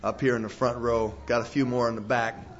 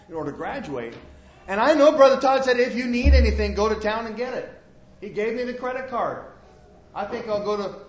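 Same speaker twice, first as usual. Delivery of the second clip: scheming worker; 7 semitones higher than usual. Acted.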